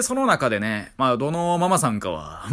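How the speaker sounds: usual speaking voice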